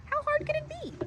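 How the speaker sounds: high, breezy boast; boastful. Acted. Tiny brags he can deliver the eggs, high and breezy. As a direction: shrug it off, sure of himself.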